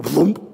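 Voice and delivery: a loud plopping bobber noise; bobber plop. Retold or imitated. Preacher imitating the bobber going under, a loud plop.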